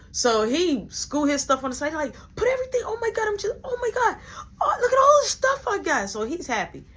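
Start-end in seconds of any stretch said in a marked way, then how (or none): 2.3-6.1 overjoyed squeal. Retold or imitated. Brother overjoyed by snacks.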